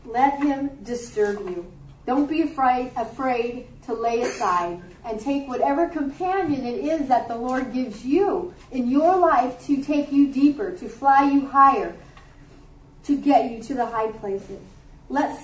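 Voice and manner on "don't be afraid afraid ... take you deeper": ordinary delivery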